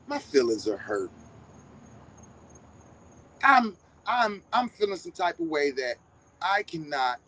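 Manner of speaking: mock hurt. Debater pretending his feelings are hurt by critics, mock hurt.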